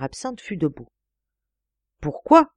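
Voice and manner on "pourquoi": higher and louder, cutting in with a startled pourquoi; sudden startled cry of why; sharp interrupting question. Acted. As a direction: jump in with an urgent why. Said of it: "interrompit-il".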